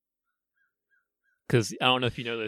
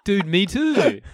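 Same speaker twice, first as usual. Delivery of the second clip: higher, louder excited fan reply; hammed-up eager teen; mock teen voice; 8 semitones higher, 7 dB louder, much slower than usual. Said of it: "replying as chat room teen".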